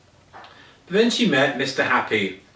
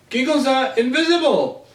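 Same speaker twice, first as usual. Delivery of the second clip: much higher, gleeful and teasing; playful excitement; 10 semitones higher than usual. A narrator's reading. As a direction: tease her with high delight. Mister Happy teasing.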